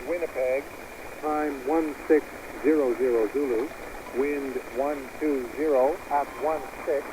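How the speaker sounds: ordinary talk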